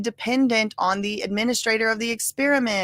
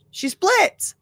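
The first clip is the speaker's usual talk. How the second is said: high echo; 10 semitones higher than usual. Shown as a demonstration. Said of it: "Yeah, she splits".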